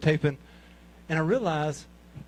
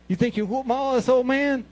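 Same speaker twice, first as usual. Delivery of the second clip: high-pitched mocking taunt; mock tough-guy challenge; taunting challenger; 8 semitones higher than usual. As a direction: imitate a cocky young challenger, pitched up. Acts young opponent taunting him.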